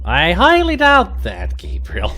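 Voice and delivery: high, slow and loud, drawn-out scoff; haughty sarcasm. Acted. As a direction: look down your nose at Gabriel, slowly. Sarcastic reply to co-player.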